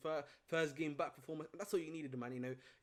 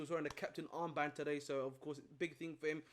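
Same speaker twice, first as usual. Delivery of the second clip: ordinary delivery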